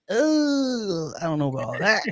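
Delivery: long, high-pitched drawn-out "oh" settling into doubt; dubious, stretched-out reaction; reluctant hedging. Retold or imitated. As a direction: stall on a high "ooh", then back away from it. Acts out reluctance at baby talk.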